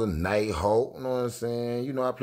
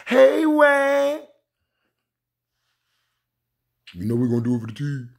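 imitating a friend; 3 semitones higher, 6 dB louder than usual. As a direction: lightly mimic a buddy calling out. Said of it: "But I don't sound like that".

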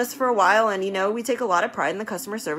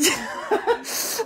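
high unvoiced burst of laughter; quick airy giggle; breathy laugh; 11 semitones higher than usual. Real, not acted. Laughing at hamster joke.

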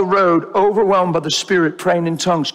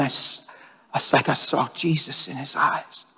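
soft, choking up on the memory; near tears; 4 semitones lower, 7 dB quieter than usual. Real, not acted. Preacher telling his church, choking up quietly. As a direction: let the tears catch the words. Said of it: "just brought me to tears".

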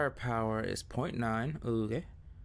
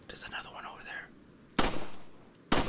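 whispering. Real, not acted. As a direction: whisper so it won't hear. Sneaking near enemy.

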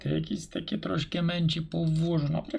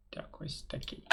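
barely voiced mutter to himself; quiet aside; 16 dB quieter than usual. Real, not acted. Teacher mutters to himself over the drawing, near-whispered. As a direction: drop to a near-whisper while checking the shape.

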